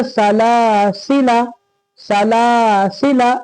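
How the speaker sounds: "सलासिला" slowly, long middle laa, short last la; middle elongation. Shown as a demonstration. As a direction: hold only the first alif in "सलासिला". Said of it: "इस अलिफ को खींचे".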